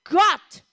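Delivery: preacher correcting himself by hitting Gott hard; stress on Gott. Shown as a demonstration. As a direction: hammer the single word Gott. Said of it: "nicht Gott der Herr, Gott".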